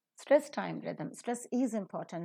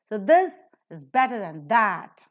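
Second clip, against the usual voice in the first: loud, with this, better and that stressed and than reduced; teacher repeating the model sentence; stressed demo sentence; 10 dB louder than usual. Shown as a demonstration. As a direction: lean on this, better and that, keep than weak. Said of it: "Than it is reduced".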